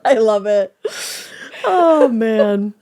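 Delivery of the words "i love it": ordinary talk, nothing marked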